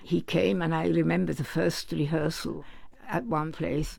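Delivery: usual speaking voice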